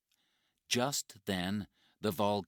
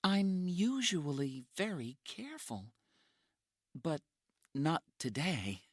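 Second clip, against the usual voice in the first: put-on tired young woman; 11 semitones higher than usual. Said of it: "She is very tired".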